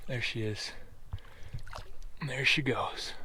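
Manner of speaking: 속삭이며 조용히; whisper. Real, not acted. Whispering while stalking the fish.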